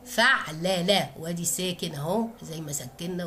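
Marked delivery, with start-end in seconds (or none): 0.1-1.1 فعلى stretched out very slowly; slow spelled-out weight. Shown as a demonstration. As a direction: drag out فعلى so students hear each letter. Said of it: "اربع حروف". (نطق فعلى ببطء شديد)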